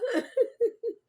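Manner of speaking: high, loud burst of laughter; real laughter. Real, not acted. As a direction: crack up at your own story. Laughs at own retold answer.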